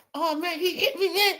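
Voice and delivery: high, whiny imitation of a player who has been hit; mock crying player. Mocking overpaid players who cry over contact, high whine. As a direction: parody a pampered star complaining to the ref. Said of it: "you crying over".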